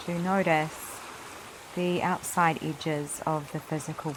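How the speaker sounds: soft, unhurried meditation voice with long pauses; guided meditation. Acted. Leads a guided yoga meditation.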